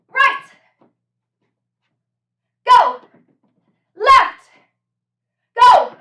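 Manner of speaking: loud high barked commands; barking gym coach. Real, not acted. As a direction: shout each cue like a drill coach. Coach calling drill cues.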